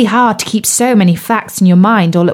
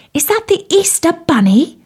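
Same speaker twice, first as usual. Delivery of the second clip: put-on high, eager child's question; little tadpole voice; 9 semitones higher than usual. Curious tadpole asking Bertie about the rabbit, high and eager. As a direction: ask it wide-eyed like a tiny tadpole.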